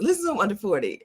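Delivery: usual speaking voice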